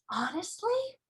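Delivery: breathless whispered honestly. Acted. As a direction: hush it in amazement. Sophie amazed whisper.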